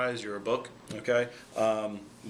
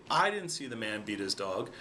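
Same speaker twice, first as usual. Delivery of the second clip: "I" pitched up and hit, rest of the sentence falling away; stress on I; 3 semitones lower than usual. Shown as a demonstration. Showing how emphasis changes meaning, stress on "I". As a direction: lean on the first word "I". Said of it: "stressing and emphasizing a different word".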